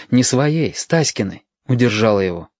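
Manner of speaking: usual speaking voice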